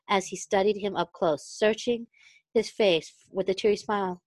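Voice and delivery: plain reading voice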